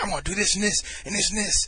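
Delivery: high-pitched jabbering imitation; really animated. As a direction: imitate a hyped-up guy rattling off plans. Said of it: "really animated. He's, like, jumping up and down".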